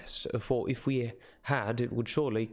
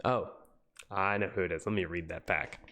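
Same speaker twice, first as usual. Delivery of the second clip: ordinary delivery, nothing marked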